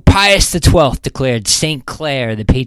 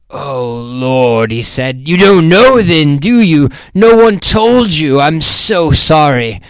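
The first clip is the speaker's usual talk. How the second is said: louder, slower, aghast sympathy; dismayed sorry; 3 semitones higher, 6 dB louder, slower than usual. A narrator's reading. Rep realizing Mandy never heard of the death. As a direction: realize she doesn't know, and apologize.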